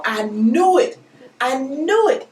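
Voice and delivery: excited I knew it. Real, not acted. Host to viewers after guessing the oil right, excited. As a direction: gloat happily at guessing right. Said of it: "let me calm down".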